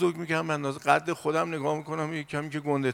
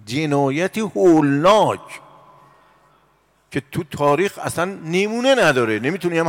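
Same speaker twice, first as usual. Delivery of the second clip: grave denunciation; 4 semitones higher, 7 dB louder than usual. Acted. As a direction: press each word down with horror. Preacher condemns terrible crime.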